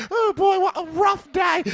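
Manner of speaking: very high, loud falsetto; odd put-on voice. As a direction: squeal it in a silly falsetto. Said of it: "Why are you talking like that?".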